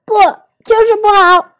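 high, put-on child voice; high-pitched childish retort; sulky contradiction. A narrator's reading. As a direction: insist stubbornly like a little boy. Oliver rejects helping each other.

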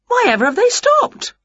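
very high, baffled witch voice; puzzled witch. A narrator's reading. Winnie puzzled outside the window.

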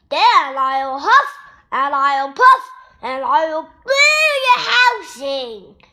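slow, loud growled threat; growling wolf. A narrator's reading. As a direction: menace them word by word. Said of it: "growled the wolf".

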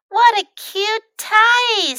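high, slow, gushing admiration; pretty fox voice. A narrator's reading. Pretty fox admires Fox's tie, high gushing voice. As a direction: coo with exaggerated delight.

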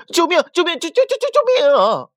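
high, loud panicked cries for help; scream, stammering with fright; terrified screaming. Acted. Myna bird screams for help as the wolf pounces, panicked. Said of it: "快嘴八哥吓得大叫".

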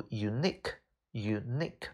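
"unique" slowly, second syllable stressed. Shown as a demonstration. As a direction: say "unique" as yoo-NEEK, drawn out. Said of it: "重音在后边".

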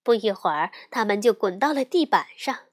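usual speaking voice

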